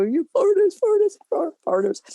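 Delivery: high, put-on Eastern European accent. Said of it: "very thick Eastern European accent".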